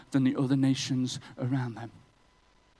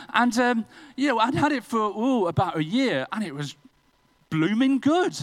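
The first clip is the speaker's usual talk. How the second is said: higher and louder, building to the pun; building excitement; 6 semitones higher, 4 dB louder than usual. Acted. Preacher building up to his blooming good pun. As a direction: ramp up to a triumphant punchline.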